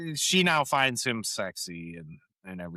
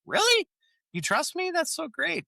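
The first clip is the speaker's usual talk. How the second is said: much higher, gushing mock delight; mock thrilled. [name] thrilled to be trusted, high mock gush. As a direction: squeal it like you can't believe your luck.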